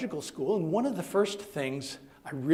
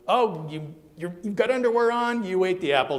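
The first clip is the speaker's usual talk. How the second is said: loud, rising mock astonishment; accusing discovery; 5 semitones higher, 6 dB louder than usual. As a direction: catch them out, playfully accusing. God catches them in story.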